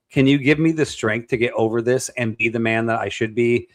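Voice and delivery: ordinary talk, nothing marked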